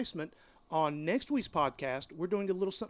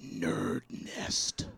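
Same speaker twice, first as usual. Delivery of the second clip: whispery sinister echo of the name; 3 dB quieter than usual. Pretend. Co-host echoing the giveaway name, sinister whisper. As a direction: whisper it slowly like a villain. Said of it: "like, the sinister voice".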